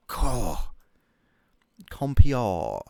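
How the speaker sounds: usual speaking voice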